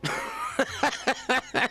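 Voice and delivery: high delighted yeah man; cheering. Real, not acted. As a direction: cheer the execution. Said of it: "Well deserved".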